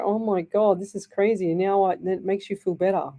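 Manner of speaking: ordinary talk, nothing marked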